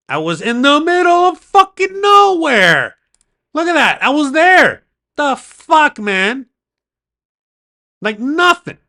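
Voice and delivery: high, loud and slow, disbelieving; real incredulous rant. Real, not acted. Vlogger ranting to his viewers about the empty road, loud. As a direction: shout how empty the place was.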